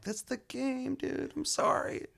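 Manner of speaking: hammed-up smug traitor; mock apology. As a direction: tease the victim with a fake sorry. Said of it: "I'm like".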